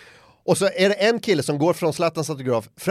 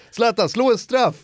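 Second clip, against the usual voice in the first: put-on higher, eager shout of a child; eager kid call; 9 semitones higher, 4 dB louder than usual. A kid asks Zlatan for a penalty, eager call. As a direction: beg him to take a penalty.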